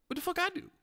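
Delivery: high, squeaky mock-offended what; mock outrage. Joking with wife's old friend, squeaky mock-offended protest. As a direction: pretend shock at being called out.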